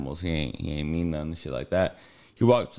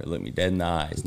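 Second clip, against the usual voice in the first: normal talking voice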